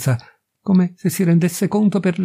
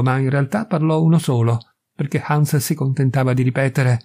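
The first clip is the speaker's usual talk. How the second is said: usual speaking voice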